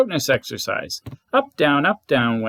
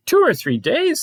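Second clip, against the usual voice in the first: much higher, aghast question; shocked disbelief; 12 semitones higher than usual. Acted. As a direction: gasp out the days in disbelief. Pooh shocked at Gopher's estimate.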